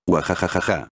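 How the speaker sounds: a lightly voiced evil cackle; hammed-up wicked laugh, mildly done; gleeful villain cackle. A narrator's reading. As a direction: cackle like a gloating little sorcerer. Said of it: "dijo animado Babidi".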